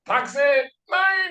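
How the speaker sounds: very high shouted "Taxi, mine"; mock German shout. Co-host joking about Germans, shouted cab call. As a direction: yell it like a German calling a cab. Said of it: "Don't hail a cab in Germany".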